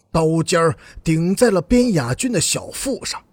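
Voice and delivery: ordinary delivery, nothing marked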